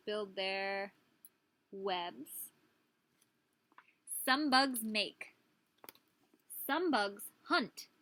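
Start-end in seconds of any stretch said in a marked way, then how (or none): 4.3-7.9 put-on reading to children. Reading book aloud.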